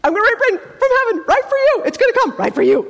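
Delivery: high, loud, fast gushing; hammed-up excitement, rushing the words; all excited. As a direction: burst out with thrilled, rapid promises. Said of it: "He's, like, all excited".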